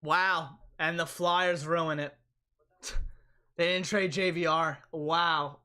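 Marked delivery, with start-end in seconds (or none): none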